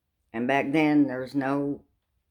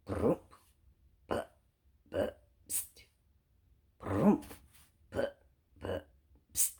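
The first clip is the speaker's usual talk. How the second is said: car sputter; 5 semitones lower, 7 dB quieter, slower than usual. Retold or imitated.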